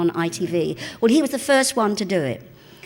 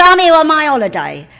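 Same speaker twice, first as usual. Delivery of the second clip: imitating a loud, higher, snooty no; haughty refusal; 8 semitones higher, 13 dB louder than usual. Kenny refuses a fan's autograph, loud and snooty. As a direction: brush the fan off loudly. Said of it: "He said".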